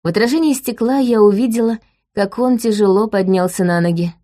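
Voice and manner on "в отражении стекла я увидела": usual speaking voice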